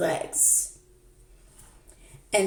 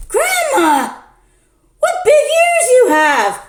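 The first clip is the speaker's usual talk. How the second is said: put-on much higher and louder childlike cry; girlish shout; 7 dB louder than usual. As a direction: shout it as an astonished little girl.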